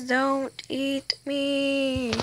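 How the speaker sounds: reading each word slowly, pointedly; slow spelled-out reading. Shown as a demonstration. Kid reading the sign out slowly to other players. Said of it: "It says".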